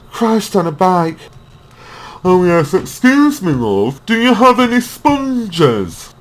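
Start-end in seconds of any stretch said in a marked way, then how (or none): 2.2-6.1 posh customer. Pretend. Customer voiced in shop skit.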